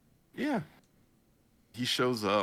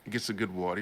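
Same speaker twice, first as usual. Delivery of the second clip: ordinary talk, nothing marked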